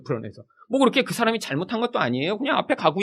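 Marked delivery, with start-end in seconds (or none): 0.0-0.4 불안해서 repeated much softer, falling; a hushed aside echoing his own words; quiet echo. Shown as a demonstration. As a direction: drop the voice almost to nothing on 불안해서. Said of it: "저희가 불안해서 못하고 있는 거예요".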